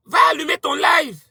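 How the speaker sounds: high shouted order. Real, not acted. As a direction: yell it at her. Lui ordonne d'aller faire son live. (crie-lui d'aller allumer son live)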